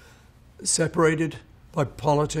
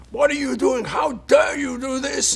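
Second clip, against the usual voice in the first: higher, louder outraged shout; furious caller; 11 semitones higher, 3 dB louder than usual. Retold or imitated. Mister Leon shouting down the phone at the singer about the song. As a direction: yell it like an offended caller. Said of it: "this furious phone call".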